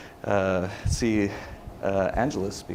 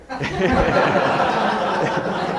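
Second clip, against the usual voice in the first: big high laugh, louder; 9 semitones higher, 5 dB louder than usual. Real, not acted. Punchline of the bar story, loud higher laugh. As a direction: burst out laughing at your own story.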